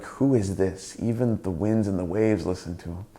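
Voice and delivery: low, softened wonder; hushed amazement. Acted. Disciples amazed that the storm listens, in subdued wonder. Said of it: "the disciples were amazed".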